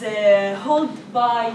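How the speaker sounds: normal talking voice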